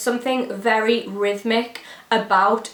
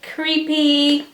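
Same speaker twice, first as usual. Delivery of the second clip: mock creepy; 6 semitones higher than usual. Spooky word said spooky.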